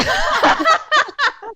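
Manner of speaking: a bright, high laugh that bursts out; big high laugh after the joke; high burst of laughter. Real, not acted. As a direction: laugh at your own joke. Laughing at her own joke.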